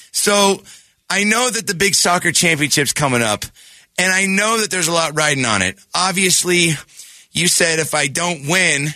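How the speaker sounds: put-on high, earnest young boy pitching; eager kid voice. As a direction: plead your case like a seven-year-old. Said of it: "I'm gonna be the kid".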